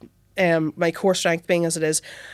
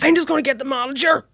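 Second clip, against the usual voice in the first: higher, awkward retold announcement; mild mock panic; 7 semitones higher than usual. Waitress to drenched customer after spilling a pint, mildly panicked. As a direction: make a hasty excuse, pitched up.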